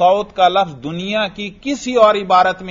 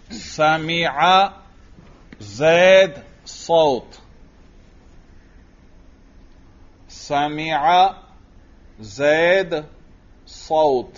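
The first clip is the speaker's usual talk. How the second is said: very slow, word by word; drawn-out words for students writing along; slow dictation; much slower than usual. Shown as a demonstration. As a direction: dictate each word separately, leaving long pauses. Dictating a sentence to write.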